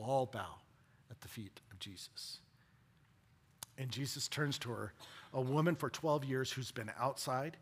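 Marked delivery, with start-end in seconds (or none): 1.1-2.4 hushed reverent whisper. Real, not acted. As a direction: breathe it out in awe. Reverent sermon moment.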